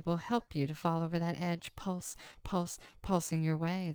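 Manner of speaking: ordinary talk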